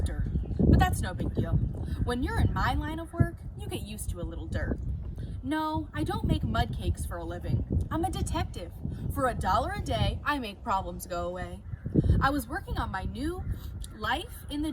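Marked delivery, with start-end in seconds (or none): none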